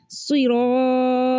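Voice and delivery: सिरातल्लज़ीना with thick T, "tolla"; full-mouthed heavy recitation. Shown as a demonstration. Teacher modelling the rule for learners, heavy and held. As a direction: draw out सिरातल्लज़ीना with rounded heavy T. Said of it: "मोटा ही पढ़ना है".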